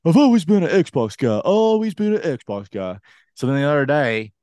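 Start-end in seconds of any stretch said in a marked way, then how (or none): 0.0-3.0 high, loud mocking imitation; Colin. Ribbing a friend's Xbox loyalty, exaggerated mocking voice. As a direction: mimic a loyal fanboy in a put-on voice. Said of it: "He's like".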